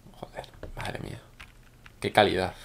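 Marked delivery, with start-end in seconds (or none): 0.1-1.2 whispered under the breath in amazement; awed whisper. Real, not acted. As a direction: breathe it out, barely voiced. Awed at a skilled player.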